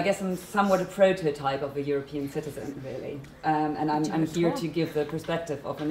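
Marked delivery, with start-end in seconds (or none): none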